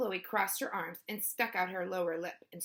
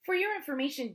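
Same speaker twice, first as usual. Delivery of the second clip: higher, know-it-all teasing; mocking correction; 6 semitones higher, faster than usual. A narrator's reading. Sister correcting her brother about puppies, high and mocking. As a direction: correct them in a high, superior tone.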